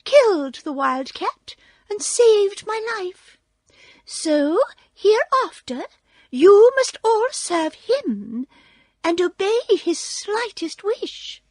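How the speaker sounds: high, squeaky, commanding queen voice; mouse queen. A narrator's reading. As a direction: issue a royal decree in a tiny squeak. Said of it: "she answered".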